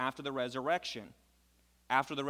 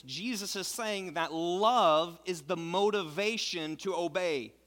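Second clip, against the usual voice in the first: louder, higher preacher pressing the point; emphatic preaching; 5 semitones higher, 5 dB louder than usual. Acted. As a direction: drive home that love comes first. Preacher driving the point home.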